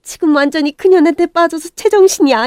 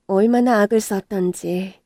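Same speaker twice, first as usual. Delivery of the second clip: normal talking voice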